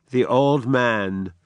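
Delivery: usual speaking voice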